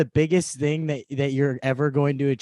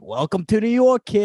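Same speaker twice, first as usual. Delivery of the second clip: put-on New York accent; 7 semitones higher, 5 dB louder than usual. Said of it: "[name] all of a sudden has a New York accent".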